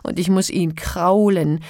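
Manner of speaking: loud, high-pitched complaint; klagend. A narrator's reading. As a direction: lament your daily ordeal, loudly. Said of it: "klagte sie". (laut und klagend)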